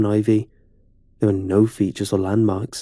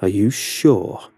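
tight voice; slower than usual. Acted. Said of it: "he said in a tight voice".

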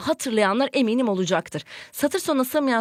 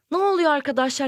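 ordinary delivery

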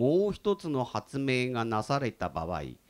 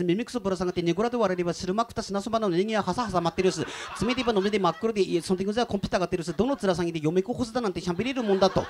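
fast, raised Tsugaru dialect recital; thick Tsugaru dialect; 4 semitones higher, faster than usual. Shown as a demonstration. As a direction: rattle off in heavy Tsugaru dialect. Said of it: "一行も理解できませんでしたね".

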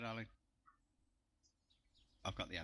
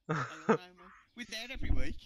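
laughing; 11 semitones higher, 13 dB louder, faster than usual. Real, not acted. Laughing, defensive.

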